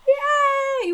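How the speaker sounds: a high, loud little yay; excited cheer. Real, not acted. Cohost cheering her pick of the K O show, excited yay.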